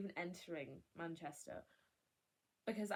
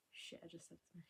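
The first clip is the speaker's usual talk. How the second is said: whisper; 2 semitones lower, 13 dB quieter than usual. Real, not acted. Realises she said his name.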